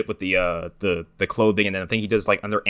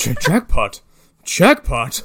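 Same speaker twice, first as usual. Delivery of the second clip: louder mock announcer jackpot; toy jackpot call; 4 semitones higher, 3 dB louder, much slower than usual. Imitating the slot machine toy announcing, mock.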